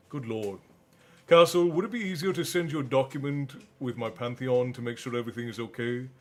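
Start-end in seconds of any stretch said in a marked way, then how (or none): none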